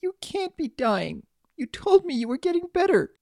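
high, anguished filly protest; tearful child. A narrator's reading. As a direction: protest through tears in a little girl's voice. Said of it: "hot tears start to cascade".

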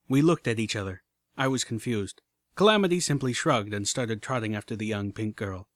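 ordinary delivery, nothing marked

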